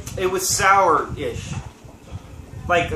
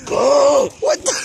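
startled shout; 6 semitones higher, 4 dB louder than usual. Real, not acted. Friend suddenly punched him.

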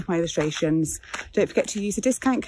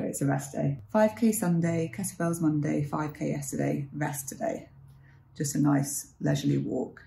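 ordinary talk

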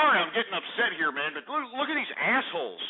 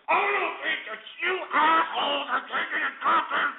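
high-pitched, exasperated yelling; fed-up outburst; 7 semitones higher than usual. Real, not acted. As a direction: shout at people who won't take you seriously. Host fed up with pranksters.

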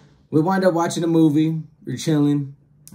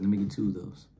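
low casual order; 4 semitones lower, 6 dB quieter, faster than usual. Retold or imitated. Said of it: "I was like".

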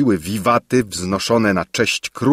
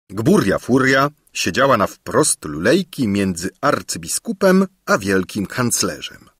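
plain reading voice

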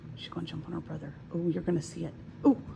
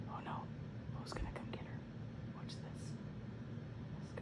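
whisper; 17 dB quieter than usual. Real, not acted. Whispering while watching cats.

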